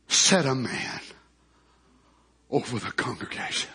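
very low, slow, hushed reading; solemn scripture reading. Real, not acted. Reading Moses' plea to the congregation, solemn scripture reading. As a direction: read it slowly and low, pausing between phrases.

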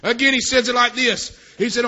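usual speaking voice

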